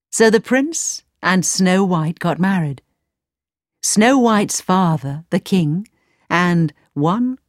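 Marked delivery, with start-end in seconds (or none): none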